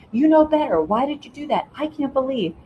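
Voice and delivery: acted reproach of an upset parent; scolding parent. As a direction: scold like an exasperated parent, without real yelling. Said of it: "yelling at them in a sense".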